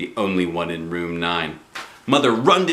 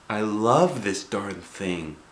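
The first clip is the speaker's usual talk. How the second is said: real sad; slower than usual. A narrator's reading. Girl sadly hugging her tool belt. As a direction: mourn the beloved tool belt. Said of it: "I said real sad".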